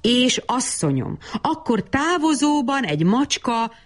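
high, lilting recital of a poem; surprise verse recitation. A narrator's reading. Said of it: "meglepetésszerűen így folytatta".

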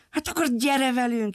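calling. Acted. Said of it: "hívta a kislány".

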